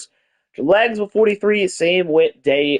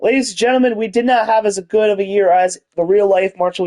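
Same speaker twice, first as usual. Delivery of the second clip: mock announcer; 4 semitones higher than usual.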